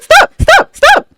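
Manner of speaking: high, loud repeated cries; frantic shouts. Real, not acted. Fan begging the show to stop, frantic high shouts. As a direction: yell stop over and over.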